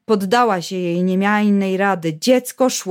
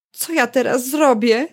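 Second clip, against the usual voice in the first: plain reading voice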